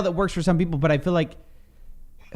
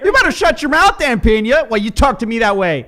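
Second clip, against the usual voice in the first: mock angry retort; 4 semitones higher, 7 dB louder than usual. Talking back at the video.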